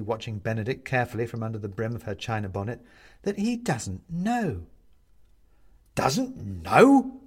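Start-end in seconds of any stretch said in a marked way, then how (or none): 3.2-4.7 pointed explanation. A narrator's reading. Woman explains Benedict's ignorance. 5.9-7.2 loud, incredulous question; aghast. A narrator's reading. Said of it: "The man takes a step back, aghast".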